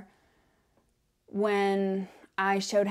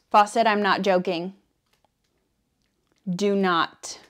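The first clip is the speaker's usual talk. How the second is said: scolding the cat; 7 dB louder than usual. Real, not acted.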